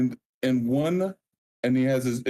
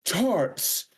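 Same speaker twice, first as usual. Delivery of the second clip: grand proclamation; 7 semitones higher than usual. Acted. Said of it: "grand proclamations".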